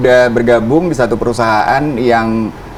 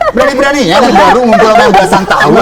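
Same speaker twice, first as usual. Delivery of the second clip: mock scolding; 10 semitones higher, 6 dB louder, faster than usual.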